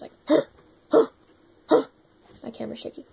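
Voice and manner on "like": raspy bark breath. Shown as a demonstration. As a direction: push air out like a choking dog bark. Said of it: "then I add noise".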